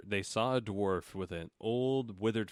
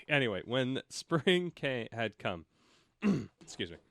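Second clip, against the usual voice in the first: ordinary talk, nothing marked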